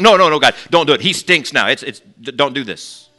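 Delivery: loud, urgent protest; frantic plea. Retold or imitated. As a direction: beg him to stop. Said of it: "Martha's like".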